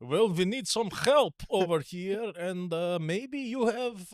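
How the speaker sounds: put-on slow, drawn-out Russian-accented request; Putin's Russian accent. Putin angling for help from North Korea, slow Russian accent. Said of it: "Putin's like".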